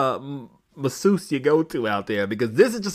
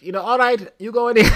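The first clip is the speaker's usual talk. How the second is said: higher-pitched, louder quote of the masseuse; high masseuse quote, no accent; quoting the masseuse; 10 semitones higher, 6 dB louder than usual. Retold or imitated. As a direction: put on a high voice for her instructions. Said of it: "she's like".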